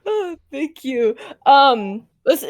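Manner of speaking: ordinary talk, nothing marked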